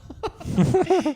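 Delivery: sudden high burst of laughter; high loud laugh. Real, not acted. Laughing with podcast friends about the hookup. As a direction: crack up at the memory.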